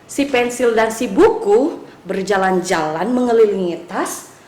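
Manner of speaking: ordinary delivery, nothing marked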